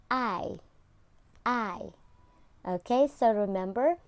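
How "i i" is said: I drawn out with the jaw dropped; long I sound. Shown as a demonstration. Teacher showing pupils the long I sound. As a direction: open wide on I. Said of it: "drop your jaw".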